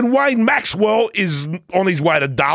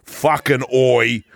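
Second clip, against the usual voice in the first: lightly voiced Aussie fan; 6 semitones lower than usual. Proud Aussie fan toasting Jack's win, lower and slowed. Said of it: "Hello, Boris".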